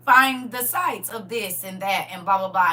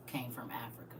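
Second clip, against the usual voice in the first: hushed, softer whisper of "came from Africa"; 11 semitones lower, 18 dB quieter, faster than usual. Real, not acted. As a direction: drop to a secretive whisper. Dramatic hushed revelation.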